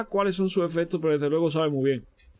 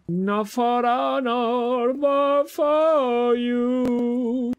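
very slow, higher, solemn English line; dramatic game quote; 7 semitones higher, much slower than usual. Pretend. Streamer dramatizes a Metal Gear quote in a slow, high, solemn voice. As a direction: stretch it out like a movie hero.

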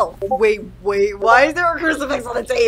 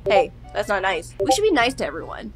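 ordinary talk

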